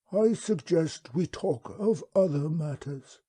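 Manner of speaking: slow, high, unhurried alien creature voice; wub's voice. Acted. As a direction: speak as a placid, courteous old beast. The wub speaks.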